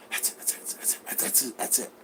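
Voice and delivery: hushed eager whisper; excited whisper. Retold or imitated. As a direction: whisper it like you've spotted the prize. Suitor spotting the house.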